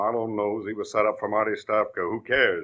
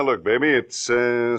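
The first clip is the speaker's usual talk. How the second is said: usual speaking voice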